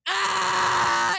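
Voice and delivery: high, loud sobbing wail; reliving a sob in the doctor's office, loud crying wail; retold sobbing cry. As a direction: let out a crying wail as she remembers it. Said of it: "It's just like".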